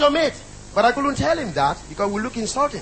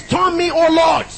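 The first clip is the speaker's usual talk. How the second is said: proclaimed prayer; 5 semitones higher, 5 dB louder, slower than usual. Shown as a demonstration. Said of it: "The word is".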